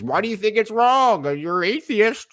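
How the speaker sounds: high, loud mock whine; mock the believer's whiny question; mocking opponent. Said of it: "your only response is".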